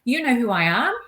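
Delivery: high-pitched indignant disbelief; stunned and affronted; taken aback. Retold or imitated. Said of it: "I was so taken aback".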